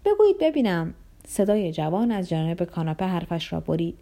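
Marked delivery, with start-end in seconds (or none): 0.1-0.9 put-on raised, higher voice of the young man. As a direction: cut in from the couch.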